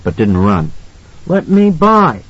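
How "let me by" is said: put-on scared little boy. A narrator's reading. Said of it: "Danny flinched back".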